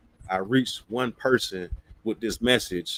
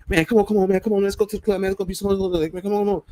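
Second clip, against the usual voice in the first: eager pleading; 7 semitones higher, much faster than usual. Retold or imitated. Friend hyping the speaker to hit the club, high and eager. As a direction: hype him into going out.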